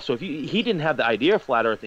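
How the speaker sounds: normal talking voice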